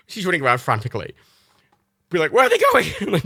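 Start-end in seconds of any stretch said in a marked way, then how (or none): 2.3-2.8 very high, loud, frantic squeal; panicking dog voice. Retold or imitated. Dog Matilda panicking as her owners leave, squealing very high and loud. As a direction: wail it like an abandoned puppy. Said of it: "my impressions of Reagan and Matilda".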